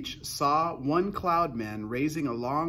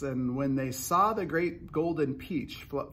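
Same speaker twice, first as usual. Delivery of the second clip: ordinary delivery, nothing marked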